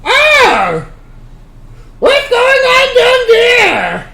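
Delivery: high, loud, slow shout through a full mouth; shouting through a mouthful of food. Acted. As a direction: yell with your cheeks stuffed. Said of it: "With a mouthful of food, Azzy goes".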